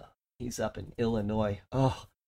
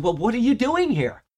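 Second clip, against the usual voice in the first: a higher, louder, fed-up repeat of the question; exasperated gatekeeper; 11 semitones higher, 6 dB louder than usual. Retold or imitated. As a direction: ask again, losing patience. Gatekeeper exasperated, asks again.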